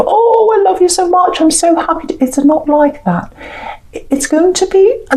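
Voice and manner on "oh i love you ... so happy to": high, gushing and starry-eyed; gushing lovey-dovey. Retold or imitated. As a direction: gush it out like a smitten lover. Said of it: "this huge surge of".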